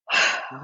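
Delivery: acted heavy exhale; imitated exasperated sigh; resigned sigh. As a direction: sigh as if giving up. Retold exasperated response of fiance.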